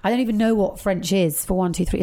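ordinary talk, nothing marked

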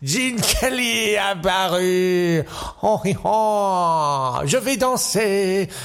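milk every syllable, fading to the end; Gene Kelly. Acted. Kelly drawling mock French to a finish.

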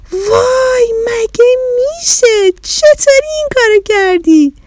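very high astonished cry, stretched; amazed cry. Acted. Children amazed to find themselves home, high stretched cry. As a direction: gasp in wonder like a thrilled child.

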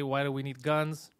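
ordinary talk, nothing marked

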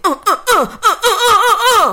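high, loud comic "uh" bursts; loud laughing mock grunts; rhythmic mock grunts. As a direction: punch out silly rising yelps. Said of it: "It was the ending".

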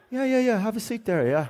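high, jittery, placating yeahs; nervous yes-yes. Retold or imitated. Terrified prisoner seating the violent inmate, nervous high placating. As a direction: soothe a killer you are scared of. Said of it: "I was like".

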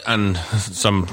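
ordinary talk, nothing marked

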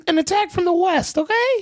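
put-on high boyish voice barking orders; unbroken boy voice. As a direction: command troops like a boy before his voice broke. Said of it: "my voice hadn't changed yet".

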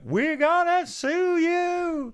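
very high, drawn-out mock shriek; Hannah. Imitating a friend threatening the airline supervisor, shrill mock shriek. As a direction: screech the threat slowly, hammed-up. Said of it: "And then Hannah's going".

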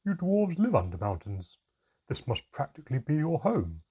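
loud, high incredulous protest. A narrator's reading. Mermaid assumes visitor lives under mountains, incredulous. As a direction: point out the obvious in disbelief.

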